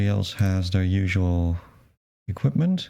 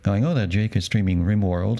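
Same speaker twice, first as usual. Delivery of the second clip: normal talking voice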